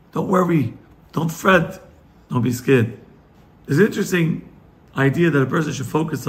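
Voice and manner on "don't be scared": normal talking voice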